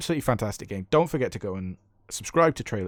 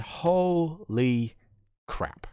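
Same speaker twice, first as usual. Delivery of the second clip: syllable-by-syllable astonishment; stunned amazement; 5 semitones higher, much slower than usual. Real, not acted. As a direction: stretch it out in disbelief. Amazed at the final damage score.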